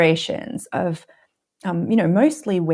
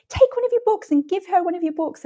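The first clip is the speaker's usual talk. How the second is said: husband's advice; 11 semitones higher than usual. Retold or imitated. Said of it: "Mark was like".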